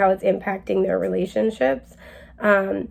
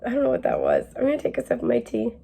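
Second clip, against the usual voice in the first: ordinary talk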